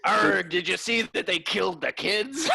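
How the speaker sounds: high, loud pirate voice. Pretend. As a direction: ham it up like a pirate. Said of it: "in a pirate voice".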